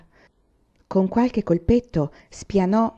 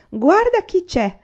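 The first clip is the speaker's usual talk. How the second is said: higher and louder, slow delighted cry; glad exclamation; 12 semitones higher, 5 dB louder, slower than usual. A narrator's reading. Mrs Power greeting Laura at the door, delighted exclamation. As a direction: welcome the girl in with a happy cry. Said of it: "esclamò la signora Power".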